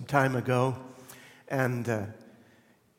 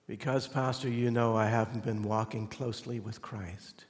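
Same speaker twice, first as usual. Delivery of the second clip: remorseful confession; 3 semitones lower, 5 dB quieter than usual. Retold or imitated. Friend's remorseful confession after pause.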